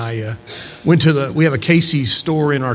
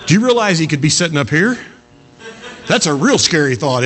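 normal talking voice

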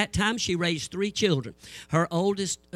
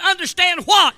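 loud, high snapping question; exasperated snap; 11 semitones higher, 10 dB louder than usual. Retold or imitated. Preacher to the assistant pastor, a loud high exasperated demand. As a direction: bark the question out.

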